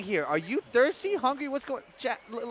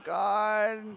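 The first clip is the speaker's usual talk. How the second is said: ordinary talk, nothing marked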